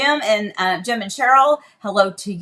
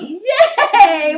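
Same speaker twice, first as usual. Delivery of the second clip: cheer; 9 semitones higher, 7 dB louder than usual. Real, not acted. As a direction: cheer the little one on. Cheering with toddler.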